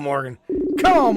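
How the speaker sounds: ordinary talk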